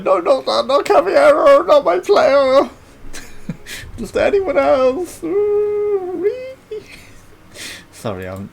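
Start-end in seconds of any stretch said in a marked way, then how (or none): 0.0-5.2 high, slow whining plea; whiny pleading impression. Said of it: "Then it's like". 5.2-6.8 mock squeal. Mocking squeal after impression.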